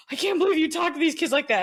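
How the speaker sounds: mock scandalised old lady; shocked old lady. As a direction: clutch your pearls as you say it. Shocked old ladies quoted.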